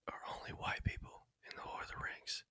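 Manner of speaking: hushed, very soft whisper; whispered aside. Real, not acted. Admitting a favorite movie's flaw, whispered aside. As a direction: drop to a whisper, as if confiding.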